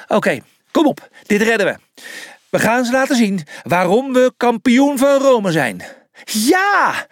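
rallying cry, rising to a high cheer; high-pitched, rousing battle cry; team pep talk. Acted. Rallying the team before match.

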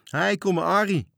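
loud, high hail across the water; calling out. A narrator's reading. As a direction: shout his name to the other ship. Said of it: "riepen de vijf matrozen".

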